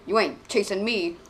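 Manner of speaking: ordinary delivery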